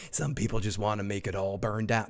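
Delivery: low, quiet growly-movie-villain imitation; Jack Nicholson. Host quoting a Dark Knight line, low hushed imitation. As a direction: drop low and soft for a movie-star impression. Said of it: "say that like Jack Nicholson".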